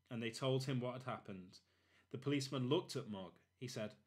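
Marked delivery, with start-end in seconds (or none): none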